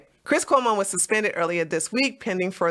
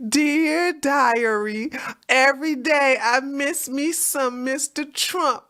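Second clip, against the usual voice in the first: slow, dreamy mock diary voice; mock diary reading; 3 semitones higher, slower than usual. Mocking Acosta as if writing his diary, slow and dreamy. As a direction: read it like a lovesick diary entry.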